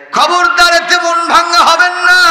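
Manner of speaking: preaching at full force. Acted. Preacher proclaiming God's answer to the enemies, fiery shout. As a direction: thunder it out as God's own answer.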